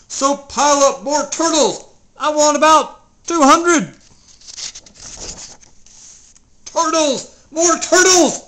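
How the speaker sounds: very loud, high braying demand; bellowing. Acted. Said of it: "he bellowed and brayed".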